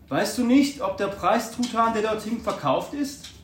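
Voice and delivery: put-on eager question to the boy. A narrator's reading. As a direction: press the boy, eager for the answer.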